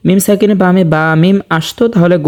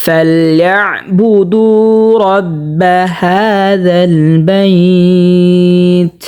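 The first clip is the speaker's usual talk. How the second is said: very slow, drawn-out tilawat chant; slow melodic Quranic recitation; much slower than usual. Shown as a demonstration. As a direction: recite each word with long held vowels. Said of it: "যেমন".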